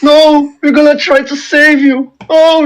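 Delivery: high wailing plea over a dying master; a high, grieving cry to a fallen lord. Acted. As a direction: sob it out in a high voice. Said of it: "I start to cry and say".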